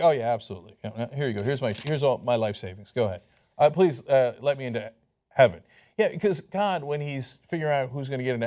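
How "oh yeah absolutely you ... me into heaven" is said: much lower, dopey believer voice; mock gullible believer. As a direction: play the naive believer handing over savings. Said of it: "people are like".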